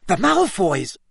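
high, startled exclamation; sudden loud outburst. A narrator's reading. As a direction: blurt it out in sudden alarm. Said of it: "so loudly that his voice echoed".

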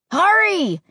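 high, loud shout back; calling out. Acted. Josh calling to the others from far ahead, loud high shout. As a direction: yell it back over your shoulder. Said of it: "Josh called back to us".

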